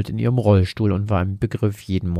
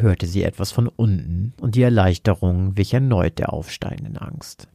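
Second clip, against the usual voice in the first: usual speaking voice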